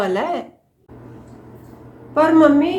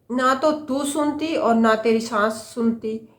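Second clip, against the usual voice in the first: ordinary talk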